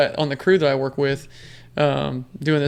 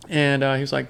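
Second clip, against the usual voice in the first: usual speaking voice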